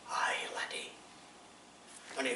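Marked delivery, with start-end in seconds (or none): 0.1-0.9 unvoiced whispered aye laddie; whispered reply. Sergeant quietly approves the soldier, whispered. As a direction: breathe it as a grudging approval.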